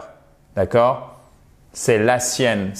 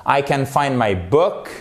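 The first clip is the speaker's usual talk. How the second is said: ordinary talk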